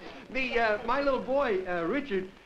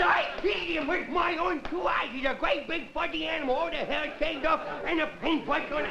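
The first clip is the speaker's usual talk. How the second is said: higher-pitched, eager insisting; insistent kid; 7 semitones higher than usual. Retold or imitated. Son insists to his dad he saw it, eager. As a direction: insist like a stubborn little boy.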